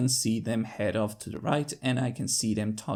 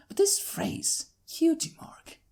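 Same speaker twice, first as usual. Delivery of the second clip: much higher, slow whisper; hushed wonder; slower than usual. Acted. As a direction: whisper it as if the words ring a bell. Pondering familiar phrase.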